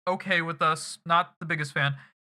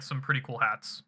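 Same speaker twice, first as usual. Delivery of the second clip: usual speaking voice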